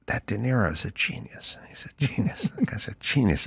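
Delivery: soft, half-whispered mock awe; hushed admiration. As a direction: murmur it like a reverent shared opinion. Acts out friends' hushed admiration.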